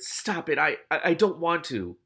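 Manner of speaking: protest, tripping over the words; stuttering. A narrator's reading. Rat catcher resisting the voice in his head, stuttering. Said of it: "the man stuttered".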